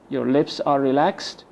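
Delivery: ordinary talk, nothing marked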